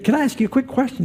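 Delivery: quick, high-pitched question to a stranger; curious tourist. Retold or imitated. Tourist politely questioning the shepherd.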